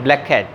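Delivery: "ब्लैक कैट" run together as one k, blek-at; "ब्लैक कैट" with one shared k sound; linked words. Shown as a demonstration. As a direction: drop the doubled k in "ब्लैक कैट". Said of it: "के sound है उसको drop कर देना है".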